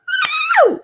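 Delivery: very high, loud shrieking laugh. Real, not acted. Host cracks up at her own cousin joke, shrieking laugh. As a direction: let out a squealing cackle.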